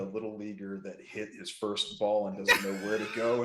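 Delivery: ordinary talk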